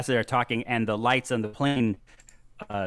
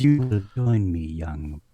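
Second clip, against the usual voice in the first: British vampire lady; 6 semitones lower than usual. Acted. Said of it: "and she says".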